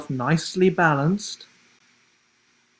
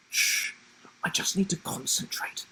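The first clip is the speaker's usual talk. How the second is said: quick unvoiced hush; fast, breathless whisper of focus; 5 semitones higher, 6 dB quieter than usual. A narrator's reading. Sam hushing friends to concentrate, whispered. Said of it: "he replied".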